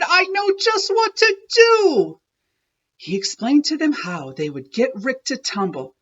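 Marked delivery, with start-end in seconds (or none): 0.0-2.2 high, loud eager cry; excited shout. Acted. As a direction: burst with the idea. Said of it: "he shouted".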